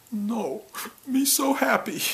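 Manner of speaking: put-on high, happy little-kid voice; toddler voice. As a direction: squeal it like a delighted two-year-old. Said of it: "And he goes".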